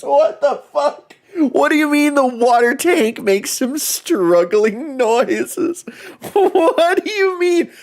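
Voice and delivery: very high, squealed through laughter; incredulous laughing. Real, not acted. Streamer reacting to struggling noises in the water tank, incredulous laughing. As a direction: crack up in high-pitched disbelief. Streamer cracks up at game line.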